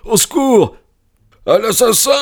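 very high, strained cry for help; near-howling cry. A narrator's reading. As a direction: scream for rescue at the top of the pitch range. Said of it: "Un cri, presque un hurlement".

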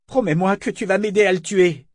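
desperate plea. A narrator's reading. Drugged Althea begging her friend, high and desperate. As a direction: beg your friend for help.

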